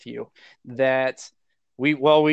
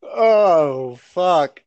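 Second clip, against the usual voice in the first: laughing; 5 semitones higher, 7 dB louder, much slower than usual. Real, not acted. Cracking up at the bit.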